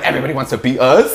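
fast, loud smug voice; mock American boast. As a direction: brag like a proud American.

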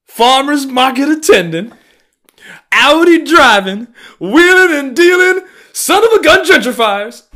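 high, loud sneering caricature of rich newcomers; hammed-up high mocking yell; mock gentrifier voice. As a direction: bellow the list like a cartoon yuppie.

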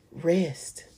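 a hushed, calm single word; hushed divine reply, almost a whisper; soft whispered answer. Retold or imitated. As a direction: answer softly and still. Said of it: "God's answer, soft".

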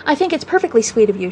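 usual speaking voice

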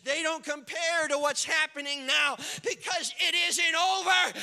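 from plain up to a loud, high shout; rising preaching shout; building sermon climax. Acted. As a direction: build to the climax, slowing at the end.

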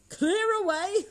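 high, rising question; incredulous echo. A narrator's reading. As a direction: echo the order in amused disbelief. Narrator echoes the order in disbelief.

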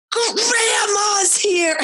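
very high, slow, laughing yell; ecstatic kid shout. Retold or imitated. Mom imitating her toddler announcing grandma, high and ecstatic. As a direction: squeal it like a thrilled little kid. Son excited grandma arrives.

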